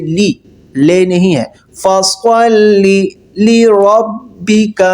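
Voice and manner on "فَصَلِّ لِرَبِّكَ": فَصَلِّ لِرَبِّكَ drawn out, higher; slow recitation. Shown as a demonstration. Teacher models the phrase slowly for students. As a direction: recite both words slowly and clearly. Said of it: "لِرَبِّكَ بھی نہیں پڑھنا".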